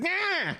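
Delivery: high-pitched "nah!" yelp; mock cry on being hit in the face; startled yelp. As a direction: yelp as if struck. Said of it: "hit him in the face".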